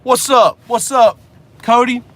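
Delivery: hollered hello through the window; calling out greeting. Real, not acted. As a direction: call out to a friend. Greeting someone outside the car.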